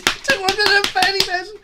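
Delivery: a delighted laughing echo of the number; laughing excited. Real, not acted. Repeats total, laughing with delight.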